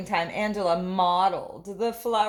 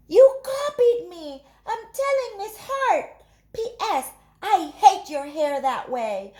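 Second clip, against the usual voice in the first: put-on much higher, louder bratty child; angry child; 9 semitones higher, 7 dB louder than usual. Angela's note accusing Ruby, high loud bratty child. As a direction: accuse her sharply.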